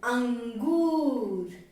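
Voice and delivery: अंगूर with the ऊ held long, slow; drawn-out angoor. Shown as a demonstration. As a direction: stretch the ऊ in अंगूर. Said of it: "अम". (अंगूर में लंबा ऊ)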